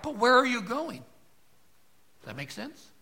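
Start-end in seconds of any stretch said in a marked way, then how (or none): 0.0-1.0 put-on high, louder child's protest; child crying out. Preacher voicing a little boy protesting to his dad, high. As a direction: plead like a five-year-old left behind. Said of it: "The five-year-old may cry out".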